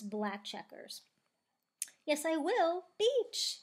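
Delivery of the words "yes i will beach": high, loud, insistent cry; excited yell. A narrator's reading. Plastic defying Stingray about the beach, excited yell. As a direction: yell it back, jumping up in pitch. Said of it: "yells Plastic".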